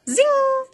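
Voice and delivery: very high, loud zing; magic gunshot zing. A narrator's reading. Narrator voicing the magic pistol, high zing. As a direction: let the shot whiz.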